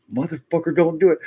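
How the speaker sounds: high, loud warning shout; re-enacted frantic command; mock angry warning. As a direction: yell at the dog to stop. Acted warning to runaway dog.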